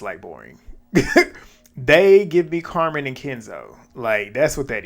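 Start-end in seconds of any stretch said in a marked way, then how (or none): 0.0-0.6 deadpan dismissal. Real, not acted. Dismissing a couple. 0.7-1.4 short laugh, pitched high; sudden high giggle; high burst of laughter. Real, not acted. Laugh after the jab.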